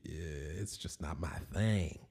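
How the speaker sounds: a slow hushed aside; whispered aside. Real, not acted. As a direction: drop to a slow hush. Whispered aside about personal taste.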